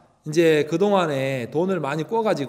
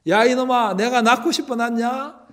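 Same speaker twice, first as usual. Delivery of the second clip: higher, sharp scolding of a rude son; angry mother; 7 semitones higher than usual. Retold or imitated. Mother scolding her rude son, higher angry retort. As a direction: snap back at the boy. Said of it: "막 화를 냈습니다".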